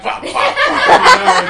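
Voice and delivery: high, loud laughing bursts of fuck; mock tic swearing. Teacher pretends his swearing tic to colleagues, high laughing bursts. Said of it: "I'm like".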